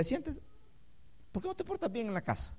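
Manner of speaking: put-on quiet, gentle correction of a child; calm parent. A calm father quietly correcting his son. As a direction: correct the child softly, no shouting. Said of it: "Qué bonito llamar a un hijo".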